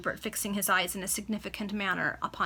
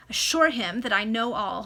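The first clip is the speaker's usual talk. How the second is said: ordinary delivery, nothing marked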